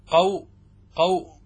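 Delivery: "قو-" as a lone syllable qaw, cut off; demonstrated sukun. Shown as a demonstration. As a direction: say "قو-" ending on a vowelless w. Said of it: "هكذا".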